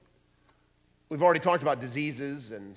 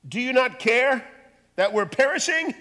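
panicked disciples; 10 semitones higher, 8 dB louder than usual. Acted. Frightened disciples in the storm.

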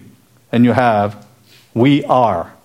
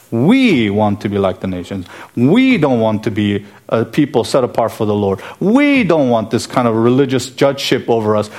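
emphatic demand. Retold or imitated. Preacher voices the people demanding a king from Samuel, emphatic. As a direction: hammer each "We" like an ultimatum.

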